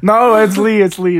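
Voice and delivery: loud insistence on the name; emphatic. Real, not acted. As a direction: correct her firmly. Insisting on his nickname.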